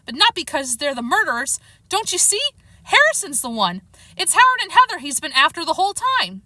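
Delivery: impatient, insistent explanation; mildly snappy, agitated retort; snapping. A narrator's reading. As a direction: explain in exasperation, pitch jumping up. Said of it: "Chester snapped".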